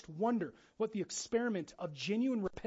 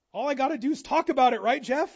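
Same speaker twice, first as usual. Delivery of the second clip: mock objector; 6 semitones higher, 12 dB louder than usual.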